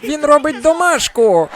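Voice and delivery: put-on very high, drawn-out girlfriend yell; mock exasperated screech. Girlfriend reports on Frankie to comic, mock screeching. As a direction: wail the answer in hammed-up outrage.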